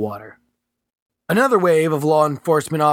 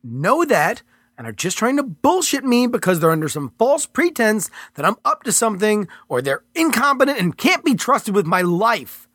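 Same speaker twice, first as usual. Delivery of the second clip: raised, tense protest; Mitchell's character voice; 7 semitones higher than usual. Acted. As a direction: argue back, higher and pressed. Mitchell arguing with agent.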